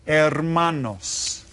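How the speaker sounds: hermanos drawn out slowly with no h sound; silent H. Shown as a demonstration. Teacher modelling the plural for learners, hermanos with silent H. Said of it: "You don't pronounce that".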